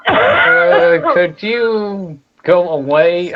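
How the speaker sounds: slow, pointed go away; annoyed dismissal. Acted. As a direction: drag it out impatiently. Wizard dismisses the giant.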